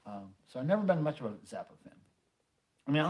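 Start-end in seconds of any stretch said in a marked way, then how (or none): none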